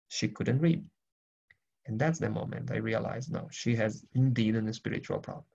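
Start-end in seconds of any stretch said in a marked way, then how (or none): none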